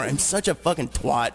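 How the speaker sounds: ordinary talk, nothing marked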